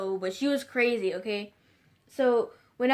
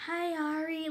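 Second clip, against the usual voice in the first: Crystal; 6 semitones higher, 6 dB quieter than usual. Retold or imitated. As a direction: fake sweet girly voice, high and soft. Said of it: "she speaks in this fake voice".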